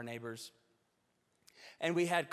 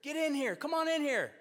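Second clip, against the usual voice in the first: much higher, louder calling out the front door; retold yell; 11 semitones higher, 6 dB louder than usual. Wife calling neighbors in from the front door, loud yell. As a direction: holler to the passers-by to come in. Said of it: "and she yells".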